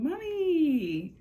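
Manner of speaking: put-on high, loud childlike call of Mommy; high excited cry of Mommy. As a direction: call for your mother like a little girl. Channelled daughter calling her mom.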